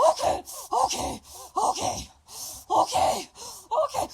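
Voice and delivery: high strained okays, barely voiced; squeaky mock mother in labor. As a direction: imitate the laboring girl's thin gasped okays. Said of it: "She goes".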